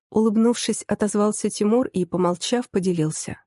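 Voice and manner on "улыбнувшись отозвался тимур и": plain reading voice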